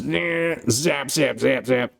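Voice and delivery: mock zapping noises. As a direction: imitate an electric prod zapping away. Imitating an electric rod zapping.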